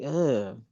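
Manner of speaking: low, soft disgusted grunt; a quiet "ugh" of disgust. Retold or imitated. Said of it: "looking at him like".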